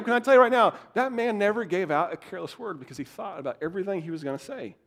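usual speaking voice

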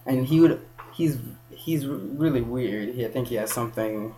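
normal talking voice

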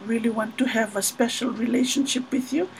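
whisper-soft nervous declaration; timid suitor confessing softly; hushed confession. Retold or imitated. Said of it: "he's like".

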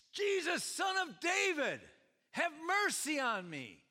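high, loud cry of a beggar; desperate yell. Acted. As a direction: cry out for mercy at full voice. Retelling the beggar's yelling.

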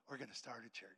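low, hushed retold statement; quiet resolve. Wife settles on starting a church, hushed. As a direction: say it very softly and low.

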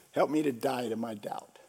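low, soft plea; hushed prayer. Retold or imitated. Praying to die to doubt, hushed plea. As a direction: pray it softly and low.

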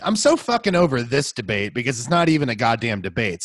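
ordinary talk

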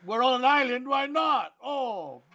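high, loud put-on Jamaican voice; mock Jamaican accent. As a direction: belt it out in a joking Jamaican accent. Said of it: "You sounded Jamaican".

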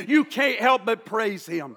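ordinary talk, nothing marked

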